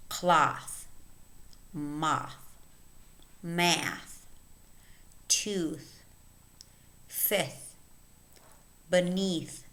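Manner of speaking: isolated words, carefully articulated with long pauses; listen-and-repeat word reading. Shown as a demonstration. Teacher reading a word list for learners, careful and separated. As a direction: read each word as a model to copy. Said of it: "Listen and repeat".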